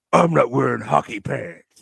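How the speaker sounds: low, put-on gruff superhero voice; mock-tough Batman-style growl. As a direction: drop low and do the movie Batman.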